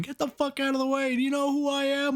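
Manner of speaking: high, strained distant yell; Teddy Lobo. Retold or imitated. Crook yelling at checkpoint cops from his car, strained yell. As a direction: imitate someone hollering from far off in a car. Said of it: "really far in the distance in his car going".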